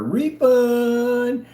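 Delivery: high, loud drawn-out call; ringing, stretched-out taunt; gloating announcement. Real, not acted. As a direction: crow it like a victory cry. Crowing over another cancelled order.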